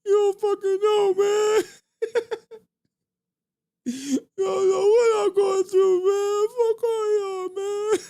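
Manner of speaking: fake-sob the complaint while cracking up; mock laugh-crying. Streamer mocks himself to chat, hammed-up laugh-crying wail. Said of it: "we can be like".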